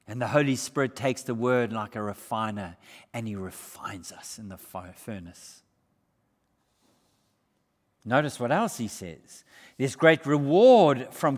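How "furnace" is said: normal talking voice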